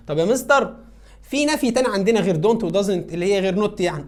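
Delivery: eager pupil asking. Retold or imitated. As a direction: pipe up like a keen student with a question.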